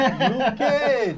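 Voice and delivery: high, loud mock Jamaican call through laughter; mimicked Jamaican accent. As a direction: belt it out in joking Jamaican. Repeats the mimicked villain, laughing.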